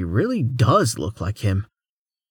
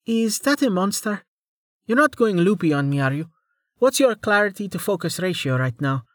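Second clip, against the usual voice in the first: higher, lighter woman's voice; female character voice; 9 semitones higher than usual. A narrator's reading. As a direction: voice Amelia a touch higher. Amelia voiced by narrator.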